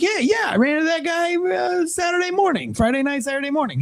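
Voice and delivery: high nervous voice. Retold or imitated. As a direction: squeak it out like you're caught off guard. Retelling his own nervous answer.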